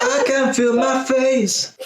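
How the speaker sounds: high, slow mock echo of the line. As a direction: parrot it back high and slow. Echoing the line, mocking.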